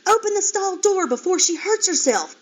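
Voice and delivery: loud, high urgent call. Acted. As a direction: shout an order across the barn. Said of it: "one of them called".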